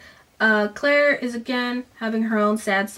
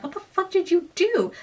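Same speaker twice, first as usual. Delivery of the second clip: principal's outburst; 9 semitones higher than usual. Retold or imitated. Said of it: "Principal Burns is like".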